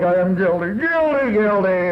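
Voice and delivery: hammed-up guilt, pitch leaping on the middle cry; mock guilty wail. Alcoholic confessing his load of guilt, mock wail. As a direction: wail it in mock self-reproach.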